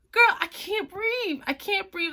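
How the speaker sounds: high, drawn-out loud gasped plea; retold panic. Telling sister she can't breathe, retold panic. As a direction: wail it high and urgent, acting it out.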